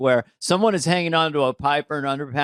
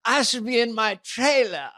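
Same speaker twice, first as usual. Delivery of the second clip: lightly mocked posh British accent; a hint of a spoiled British star; 6 semitones higher than usual. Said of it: "I thought that was a terrific take".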